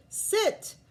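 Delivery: sharp trainer's command; commanding sit. Acted. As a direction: order the dog to sit. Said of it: "The leader said".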